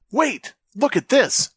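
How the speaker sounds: high-pitched urgent call. A narrator's reading. Joey stopping his friends at a find, calling out. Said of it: "Joey called out".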